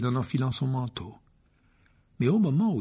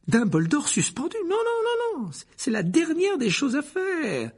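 much higher, flustered pleading; a frightened minister scrambling; panicked Fudge; 3 dB louder than usual. A narrator's reading. Fudge panics as Malfoy suspends Dumbledore. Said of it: "l'air affolé".

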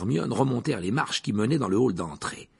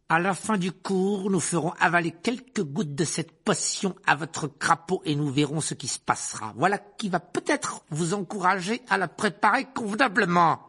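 Snape sneering; 8 semitones higher than usual. Acted. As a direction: drag out every insult to the pupil. Rogue threatens the toad. (professeur méprisant, voix haute et lente)

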